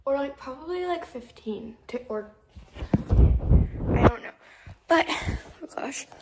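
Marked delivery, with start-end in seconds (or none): 3.1-6.1 whispering. Real, not acted. Sudden hushed aside.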